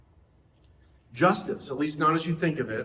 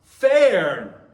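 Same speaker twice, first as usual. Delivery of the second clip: put-on drawn-out child whine, very slow and louder; whiny child; 7 dB louder, much slower than usual. A preacher imitates his child protesting unfairness, slow loud whine. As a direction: stretch it out like a sulking kid protesting. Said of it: "And they might go".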